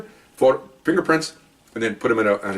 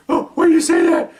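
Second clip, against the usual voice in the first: high, louder wailing question; mock crying; 4 dB louder than usual. Suspect wails at the partner, high and louder. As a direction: wail it in a squeaky hurt voice. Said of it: "Why are you crying?".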